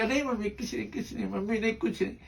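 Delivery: soft, withdrawn denial to mother; hushed evasive reply. Retold or imitated. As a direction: brush it off quietly, hiding the hurt. Daughter hiding her hurt from mother.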